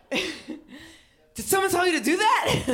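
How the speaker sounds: high, loud, drawn-out incredulous yell; retold yelling. Storyteller reenacting yelling at a woman at a party, frantic yell. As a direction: yell it in overexcited disbelief. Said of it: "I yell at this woman".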